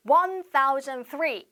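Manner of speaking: "One thousand three" read slowly as a full number; slow wrong reading. Shown as a demonstration. Teacher showing students the wrong way, slowly. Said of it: "이렇게 읽지 않고".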